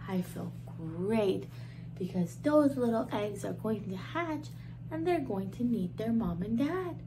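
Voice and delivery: usual speaking voice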